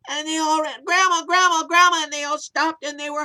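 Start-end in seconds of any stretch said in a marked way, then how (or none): 0.8-2.0 high, loud joyful calls; running up, thrilled to find her; excited calling. Acted. Kids spotting grandma, loud excited calls.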